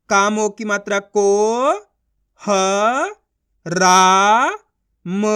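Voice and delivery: को, ह, रा, म each apart; syllable sounding out. Shown as a demonstration. Teacher drilling letters one at a time, sounding out. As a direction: stretch each sound, रा loud and long. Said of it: "उनका उच्चारण करो".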